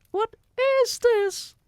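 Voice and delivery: put-on very high, loud, slow boy's voice; a put-on high kid, puzzled; cartoonish high boy asking; Bruno. A narrator's reading. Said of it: "he said".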